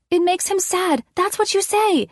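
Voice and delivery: put-on high-pitched child's voice; little girl. A narrator's reading. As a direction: explain the game like a small kid. Addison instructs the narrator.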